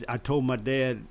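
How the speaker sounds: ordinary talk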